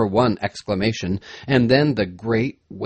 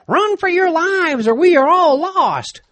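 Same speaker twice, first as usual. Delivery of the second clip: very high, desperate shout; panicked shout; 5 dB louder than usual. A narrator's reading. Saunders to men as the flood comes, panicked shout. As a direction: shout at the top of the voice to flee. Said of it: "Saunders shouted".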